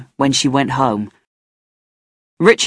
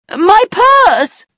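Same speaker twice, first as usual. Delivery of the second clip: high panicked shout, much louder; 6 dB louder than usual. A narrator's reading. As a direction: yell as if robbed again. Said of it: "she shouted".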